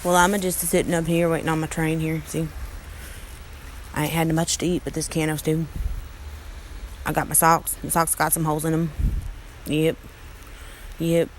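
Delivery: deep hillbilly character voice, rambling to himself; old country bumpkin. Acted. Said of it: "back to our country bumpkin".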